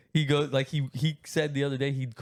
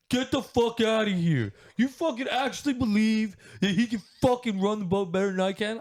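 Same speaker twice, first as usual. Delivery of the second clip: high, loud, hammed-up angry outburst; imitating furious dad; 12 semitones higher, 4 dB louder than usual. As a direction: rant like an outraged, offended father. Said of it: "He was so mad".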